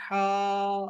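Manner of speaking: "ہا" held long on one level note; elongated letter name. Shown as a demonstration. Teacher reciting Arabic letters, "ہا" drawn out long. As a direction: stretch the vowel of "ہا" steadily. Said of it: "لمبا کرنا ہے".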